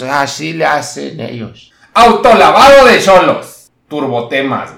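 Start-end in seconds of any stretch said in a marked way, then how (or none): 1.9-4.7 much louder, slow title announcement; announcer tagline. Acted. Narrator announcing his car-wash story as a show title, booming.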